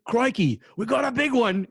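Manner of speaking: Australian accent, hammed-up wildlife-host excitement; mock Steve Irwin in an Australian accent, cheering over a big catch. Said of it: "the Steve Irwin".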